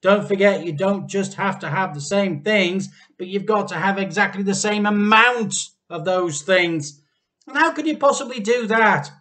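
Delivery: loud, high nagging; nagging scorn. A narrator's reading. Missus Cranky nagging the men, loud scornful voice. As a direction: lecture them with doubt.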